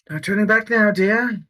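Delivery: in-character line. Acted. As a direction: play the dragon sorceress, teasing. [name] speaks in character.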